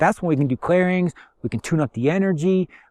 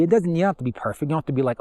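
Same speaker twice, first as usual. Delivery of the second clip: usual speaking voice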